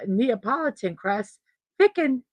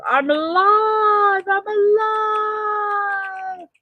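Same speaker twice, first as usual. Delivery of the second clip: drawn-out, much higher triumphant cry of "alive"; yelled out; 11 semitones higher, 4 dB louder, much slower than usual. Shown as a demonstration. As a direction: stretch each word like a victory call. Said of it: "I wanna yell it out to the world".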